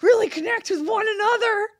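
very high, loud mock-emotional wail; overdone tearful gushing, high and loud; hammed-up gushing. Comedian mimicking two friends bonding over yawns, hammed-up gushing. Acting out an emotional phone bond.

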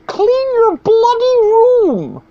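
very high, slow, drawn-out squeak; mock him in a strained high voice; hammed-up high-pitched lecturer; Jordan Peterson impression. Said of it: "high-pitched voice".

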